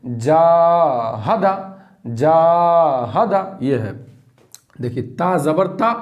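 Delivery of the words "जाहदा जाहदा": जाहदा stretched very slowly with long vowels; जाहदा sung out very slow; drawn-out vowels. Shown as a demonstration. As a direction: draw out जाहदा, holding each vowel. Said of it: "तो मजहूल से कैसे बचेंगे".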